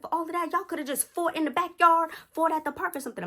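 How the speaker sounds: high, heated scolding; imitated stepdad telling off a child; snapping. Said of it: "started snapping on her".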